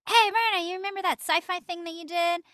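high, loud agent greeting; chirpy agent. Retold or imitated. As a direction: imitate an agent calling up brightly. Agents' phone call acted out.

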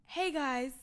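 mocking celebrity. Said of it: "Everything is just like".

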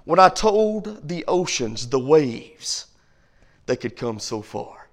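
dropping to a low whisper; hushed voice of God. Retold or imitated. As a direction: let God's words fall to a hush. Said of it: "this is what he says".

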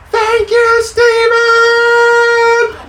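high, loud group yell of thanks. Retold or imitated. Kids and pastor yelling thanks to the tow driver, loud. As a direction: shout it as loud as you can. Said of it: "We all yelled".

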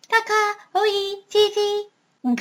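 high-pitched parrot calls; bird language. A narrator's reading. Parrot demonstrating bird language to the doctor, high squawky calls. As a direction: chirp out bird words, pitched right up. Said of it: "in bird language".

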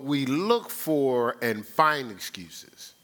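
very slow with weight on look; slow emphasis. Acted. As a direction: lay each word down slowly for the church. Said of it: "repeated line, spoken slowly".

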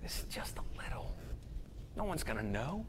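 hushed, secretive coaxing; sly tempting whisper. Acted. Sin tempting you in secret, whispered. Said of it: "Sin always tells you that".

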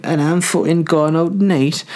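put-on high-pitched Geordie party girl caricature; mock Geordie accent. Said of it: "then they're like".

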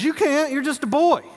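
high, loud dismissive king; Saul scoffing. Retold or imitated. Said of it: "And Saul says".

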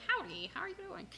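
high, soft chirpy greeting; email greeting. Acted. Podcaster quoting her own email greeting, high soft howdy. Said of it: "I say in my emails".

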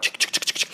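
whispered chick chick chuffing like a train; voiceless train chuff; chugging train noise. Retold or imitated. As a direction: puff out the sound of a train climbing. Mimicking a train going uphill.

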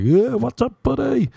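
high, loud, friendly hello; jolly it up like old pals; mock chummy greeting. Dude messing with a stranger, high, loud and chummy.

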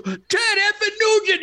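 very high, hollered fan imitation; mock ecstatic yell; excited fan shout. Acts fan jumping out of car.

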